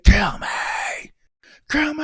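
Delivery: high, strained full-out scream. Retold or imitated. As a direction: act out a man screaming his head off. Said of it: "he was screaming".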